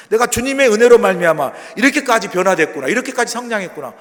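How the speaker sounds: loud, high preaching exclamation; fervent amazement. Acted. Believer sees own growth by grace, fervently amazed. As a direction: proclaim it with rising wonder and thanks.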